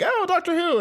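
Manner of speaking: put-on a high, light American accent; mock American accent. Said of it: "in an American accent".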